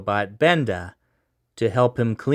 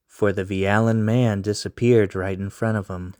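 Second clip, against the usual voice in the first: usual speaking voice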